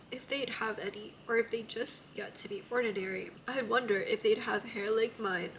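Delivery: soft, hushed emotional reading; tender story narration; reading aloud. A narrator's reading. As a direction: read the confession quietly. Reads passage aloud.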